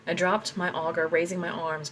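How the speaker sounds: plain reading voice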